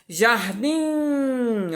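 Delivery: "jardim" with DIM held very long; singsong stretched syllable. Shown as a demonstration. Teacher marking the tonic syllable, singsong "jardim" with long DIM. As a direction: sing out the final DIM of "jardim". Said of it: "como se tivesse cantando".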